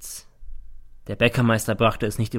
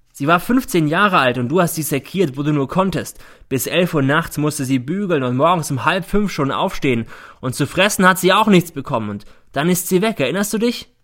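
raised, loud heated recital; accusing; 6 semitones higher, 5 dB louder than usual. A narrator's reading. Son reminding mother of Thekla, accusing. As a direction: press each charge home.

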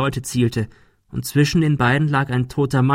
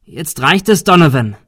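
loud, raised-pitch demand; exasperated yell at an attacker; fed-up shout; 5 semitones higher, 7 dB louder than usual. A narrator's reading. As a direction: shout him down. Confronting the gunman.

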